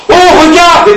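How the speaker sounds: high, loud cry of horror; horrified cry. Acted. Girl crying out to Mathias as the bulls rise, horrified. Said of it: "criai-je, horrifié".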